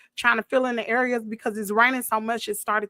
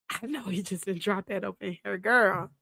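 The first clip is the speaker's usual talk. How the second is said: much softer, lower muttered disbelief; hushed disbelief; 5 semitones lower, 6 dB quieter than usual. Real, not acted. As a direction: drop the voice in disbelief.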